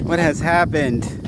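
dramatic slow outcry. Acted. Mock disaster after the take.

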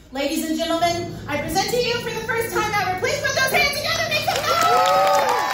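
building to a huge high showman yell; announcer. Acted. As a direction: hype the crowd like a ringmaster. Announcing the creature reveal.

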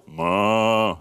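long drawn-out storybook moo; playful imitated cow lowing. A narrator's reading.

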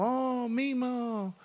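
put-on high, slow little girls' squeal of joy; imitating girls hollering. Little girls hollering to grandpa, high and excited. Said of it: "So they started hollering".

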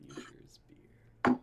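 hushed, voiceless whisper. Pretend. Host echoes the script's punchline in a whisper. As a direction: breathe it like a secret. Echoing the line jokingly.

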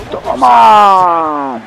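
loud shout of the final hit. Acted. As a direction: yell it as you strike. (grito al golpear)

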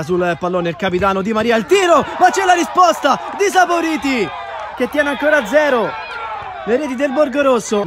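high, loud shout of the save; breathless italian goal commentary. Real, not acted. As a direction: yell out the penalty save. Penalty saved by keeper.

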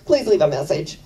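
mimicking a recorded voicemail greeting; a hint of the automated voicemail lady. Dentist's voicemail answering her call, lightly imitated recording. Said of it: "it just goes".

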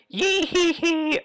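slow high-pitched cartoon giggle. Player doing a silly high giggle.

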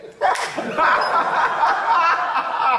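big, high, loud burst of laughter. Real, not acted. Laughing at his own story.

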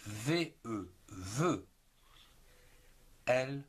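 normal talking voice

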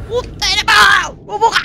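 fast, high panicked yelling; panicked shout. Real, not acted. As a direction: yell it in a panic.

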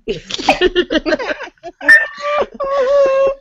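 high, excited squeal of delight; loud squee. Real, not acted. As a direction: squeal like a thrilled fan. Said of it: "I squeed very loudly just like that".

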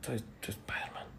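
halting, secretive whisper; barely audible whisper. Acted. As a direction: confess it under your breath. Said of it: "no te escucho, habla bien".

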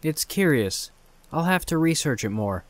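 ordinary delivery, nothing marked

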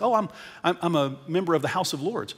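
ordinary talk, nothing marked

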